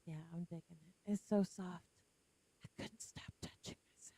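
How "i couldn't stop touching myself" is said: breathy joking whisper. Acted. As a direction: whisper it like a naughty secret. Joke about a soft shirt.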